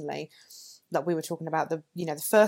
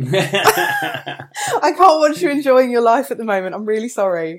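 laughing through the words, high and loud; fighting back giggles; trying not to laugh; 6 semitones higher, 12 dB louder than usual. Real, not acted. Joking about muting New Zealanders.

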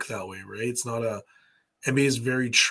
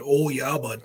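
put-on Canadian accent. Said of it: "making fun of my Canadian accent".

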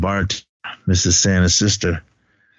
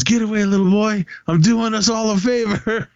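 much higher, hammed-up imitation of the attacker; mock attacker voice; 11 semitones higher than usual. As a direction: ham it up as the crazed attacker. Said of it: "And he goes".

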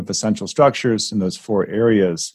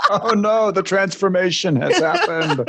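laughing; 8 semitones higher than usual. Real, not acted. Reacting to the story, laughing.

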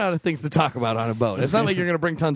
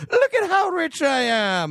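high, slow mock Scottish boast; hammed-up Scottish lady showing off; Scottish accent; 12 semitones higher, slower than usual. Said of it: "That's my Scottish accent".

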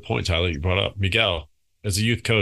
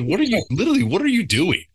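exasperated yell. Retold or imitated. Coach yelling at his son on the field, exasperated shout. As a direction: yell it like you can't believe what you saw.

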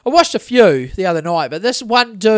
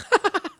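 short higher-pitched imitation laugh; mocking laughter; 12 semitones higher than usual. Gnomes laughing at the man in the coma, mock laugh. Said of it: "they're laughing at him".

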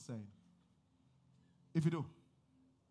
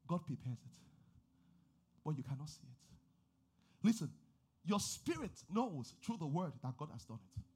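normal talking voice

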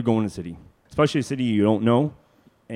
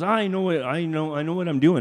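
put-on higher, self-assured old driver; a higher pitch for old Al, brushing it off; confident old man; 6 semitones higher than usual. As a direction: insist like a stubborn old man. Al insisting he knows.

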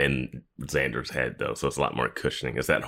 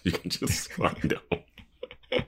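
laughing; 2 semitones higher, 5 dB quieter, much slower than usual. Real, not acted. Laughing aside about warehouse.

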